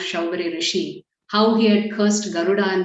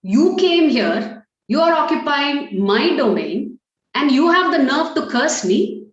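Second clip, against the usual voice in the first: acted indignation; 6 semitones higher than usual. A cursed sage confronts the trespasser, acted indignant outrage. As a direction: draw out the accusations, building higher in indignation. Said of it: "Vedashira gets very upset".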